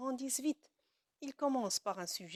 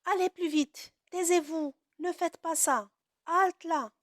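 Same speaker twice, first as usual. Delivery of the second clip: high, louder mock commands; commands demo; 6 semitones higher, 4 dB louder than usual. Shown as a demonstration. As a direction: order the class about sternly. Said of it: "il interdit". (ordres joués d'un ton sévère)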